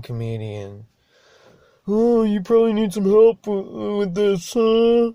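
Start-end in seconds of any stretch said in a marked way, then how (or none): 1.9-5.1 higher, louder mock-sweet taunt. Driver parodies a passenger offering help, mock sweet. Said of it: "mocking the passenger again".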